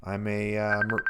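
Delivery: low, halting cool-guy voice; put-on cool voice. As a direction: drop your voice to sound cool. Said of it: "this is just a voice you're doing".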